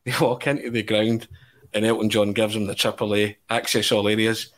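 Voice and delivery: ordinary talk, nothing marked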